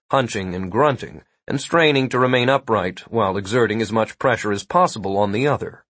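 ordinary delivery, nothing marked

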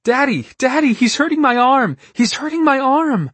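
put-on high, frantic child shrieking; piercing shrieks. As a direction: shriek it in terror. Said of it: "Addie's shrieks were so piercing".

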